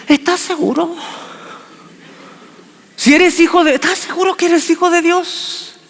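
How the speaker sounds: high mocking devil voice; devil taunting. The devil doubting Jesus, high and mocking. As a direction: needle him with a sly high whine. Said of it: "Y le dice".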